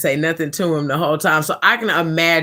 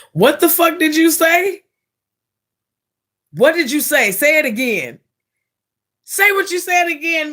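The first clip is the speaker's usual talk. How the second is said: high furious demand, pitched way up; enraged yell; 10 semitones higher than usual. Retold or imitated. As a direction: demand he repeat it, outraged. Caller enraged at rep.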